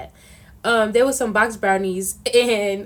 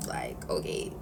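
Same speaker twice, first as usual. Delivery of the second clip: whispered okay; 12 dB quieter, slower than usual. Retold or imitated. Eye-rolling when arguing.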